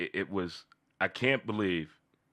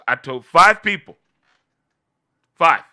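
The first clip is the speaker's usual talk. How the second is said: loud boast; 6 semitones higher, 11 dB louder than usual. Real, not acted. Boasting, louder.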